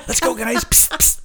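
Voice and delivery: hammed-up getaway call to the others; mock escape whisper. Disciple urging the others to flee, high mock call. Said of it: "that would be the time to run away".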